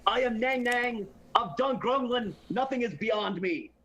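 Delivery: grandiose boast. Acted. Player declares grand identity.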